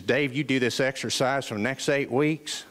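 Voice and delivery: low imitated Southern trainer; Southern accent. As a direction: promise results in a deep Southern accent. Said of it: "he has this wonderful Southern accent".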